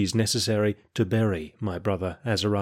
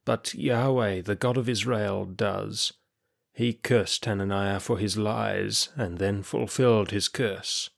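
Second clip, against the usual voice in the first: slow, softer, effortful words; weak, labored speech; 4 dB quieter, slower than usual. Acted. Weak prisoner insists it was God's curse, slowly and with difficulty. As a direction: struggle through it, drained and short of breath. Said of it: "speaking slowly and with difficulty".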